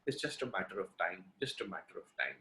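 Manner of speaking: low, hushed, resigned murmur; grim doctor's verdict. Retold or imitated. Doctor quietly tells the family it's hopeless, hushed and low. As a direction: drop it low and quiet like bad news.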